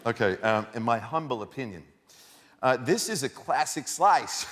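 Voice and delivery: outraged Brooklyn local shouting down fancy toppings; Brooklyn accent. Shown as a demonstration. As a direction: ham up a Brooklyn pizza critic. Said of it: "read it with a Brooklyn accent".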